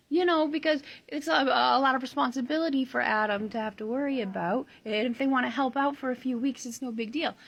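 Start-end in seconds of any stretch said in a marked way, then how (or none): none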